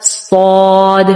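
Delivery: صاد slow and full, straight alif; heavy saad drawn out. Shown as a demonstration. Teacher modelling the heavy saad. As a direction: stretch صاد heavy and long. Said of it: "سواد نہیں بلکہ".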